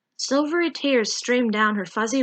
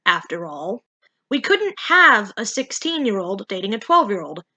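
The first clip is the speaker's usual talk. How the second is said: usual speaking voice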